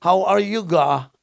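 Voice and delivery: loud, high Batangueño lilt on English; English in a hammed-up Batangas accent; teasing Batangueño accent. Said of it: "O kaya pag Batanggenyo".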